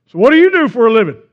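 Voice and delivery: loud, brisk, nosy question; blaring seatmate. Acted. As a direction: boom it out at a stranger. Intrusive seatmate acted out.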